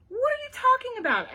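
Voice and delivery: high, loud puzzled question; baffled. A narrator's reading. Emma questions Ivy, baffled. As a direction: demand an explanation, pitched well up. Friend demands an explanation.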